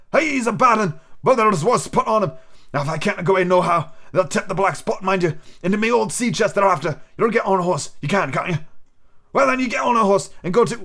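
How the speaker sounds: put-on a sick old sailor coaxing the boy; old pirate captain. Captain instructing Jim.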